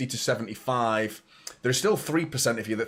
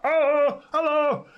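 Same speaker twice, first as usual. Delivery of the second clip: high, louder mock "Oh, hello"; imitating a stranger's greeting; 4 dB louder, much slower than usual. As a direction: squeal it like a startled stranger in the pub. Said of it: "Some guy saw me in Spoons and was just like".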